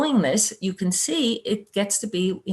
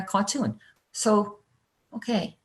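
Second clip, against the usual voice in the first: normal talking voice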